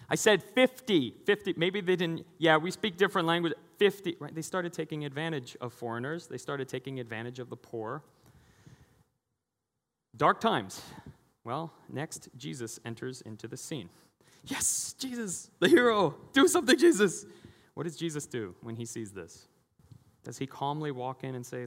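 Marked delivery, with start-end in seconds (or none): 0.1-4.3 mock swindling priest. Priest cheating a foreigner. 14.4-17.2 high, loud cheer for the hero; excited plea. Real, not acted. Cheering on Jesus to act, high and loud.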